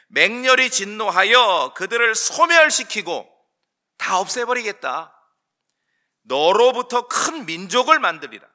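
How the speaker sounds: preacher voicing God's fury; God's wrath. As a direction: proclaim an angry divine verdict with weighty emphasis. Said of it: "맹렬히 진노하셨다라고".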